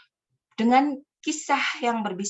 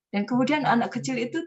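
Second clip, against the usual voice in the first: higher, amused telling of sad news; amused sad line; 7 semitones higher than usual. Shown as a demonstration. Beginner storyteller tells of crying child, amused. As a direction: tell the sad part as if finding it funny. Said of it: "menangis sedih tapi wajahnya ketawa".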